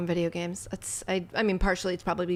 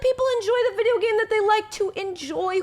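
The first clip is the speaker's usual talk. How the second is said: high-pitched exasperation, dragging out the end; exasperated plea; 12 semitones higher than usual. Real, not acted. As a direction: plead on one high strained note. Exasperated at gatekeeping commenters.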